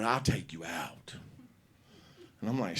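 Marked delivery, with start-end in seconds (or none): 0.0-1.1 low, soft, slow threat; menacing mom. Retold or imitated. Mama threatening her son, low and slow. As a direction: drop the voice to menace.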